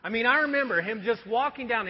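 ordinary delivery

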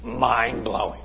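hushed pretend amazement; mock awe. Preacher feigning wonder at an obvious definition, hushed. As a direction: say it softly, as if stunned.

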